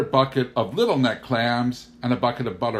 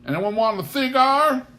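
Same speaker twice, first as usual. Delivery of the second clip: high, loud, jovial boom; hearty farmer waving off the question; 10 semitones higher, 6 dB louder than usual. Acted. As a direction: bellow it out with good cheer. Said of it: "boomed Pa".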